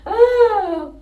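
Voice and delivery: high-pitched giggling laugh. Real, not acted. Laughing at own mistake. (mataas na halakhak)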